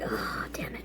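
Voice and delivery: hushed whispered dread; whispered curse at the grim building. Retold or imitated. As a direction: breathe it under your breath in dismay. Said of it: "I was like".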